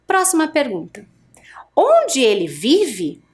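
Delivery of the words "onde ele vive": slow, careful "Onde ele vive?" as a question; question intonation. Shown as a demonstration. Teacher reads for class to repeat, slow question. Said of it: "Eu vou ler uma vez e você repete aí".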